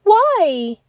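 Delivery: high, loud shocked why. A narrator's reading. Bear cub shocked his friend will leave, crying why. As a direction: blurt it in dismay.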